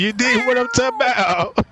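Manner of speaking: normal talking voice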